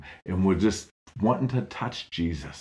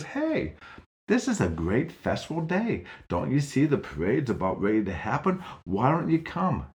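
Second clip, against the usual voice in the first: put-on bright girl voice, higher and excited; cheerful Jill; 5 semitones higher than usual. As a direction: invite him eagerly, pitched high.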